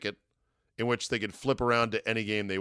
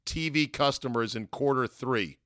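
slow, deliberate headline voice; announcer-style headline reading; 3 semitones higher, slower than usual. Shown as a demonstration. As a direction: read it like a news banner.

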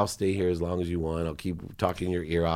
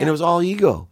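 much higher, louder burst; emphatic outburst; 10 semitones higher, 7 dB louder, faster than usual. Real, not acted. Guest admitting his anger, loud emphatic burst. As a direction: blurt it out hot.

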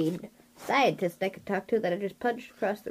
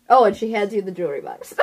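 ordinary talk, nothing marked

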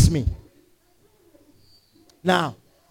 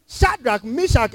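ordinary talk, nothing marked

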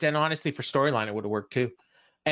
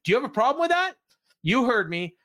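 much higher-pitched confrontational retort; mock angry; 11 semitones higher, 3 dB louder than usual. Announcer challenging the wrestler who fined him, mock angry. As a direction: imitate a mad announcer squaring up. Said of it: "Tony's all mad".

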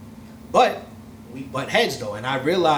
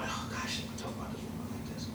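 breathy whisper, much softer, quick; whispered aside; 16 dB quieter than usual. Real, not acted. To the hosts, a guilty aside before roasting her. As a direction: hush it like a guilty confession.

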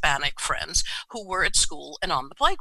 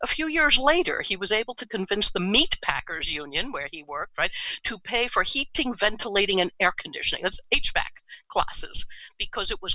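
ordinary talk

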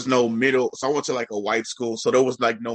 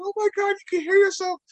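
very high, overcome mother; tearful mother; faster than usual. Retold or imitated. Mom realizing son can hear himself, tearfully. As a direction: imitate mom moved almost to tears. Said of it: "came to tears".

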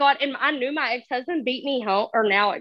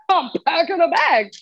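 higher-pitched defiant comeback; retold defiance; 4 semitones higher, 3 dB louder than usual. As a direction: throw it back at him, pitched up. Retold argument with husband.

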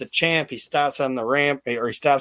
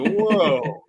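laughing, drawn-out whoa; mock a crowd going whoa; stunned whoa; 4 semitones higher than usual.

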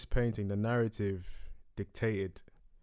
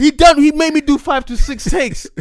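loud exasperated; 10 semitones higher, 11 dB louder than usual. Real, not acted.

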